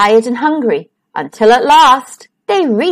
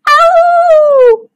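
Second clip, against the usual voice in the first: loud, high "ow" howl; long high storybook yowl; pained howl; 6 dB louder than usual. A narrator's reading. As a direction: howl out in pain like a cub. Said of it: "howled Little Wolf and Smells".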